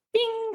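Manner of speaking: high, light "bing" chime; ping it like a little pop-up sound; cute high-pitched ding; imitated chime.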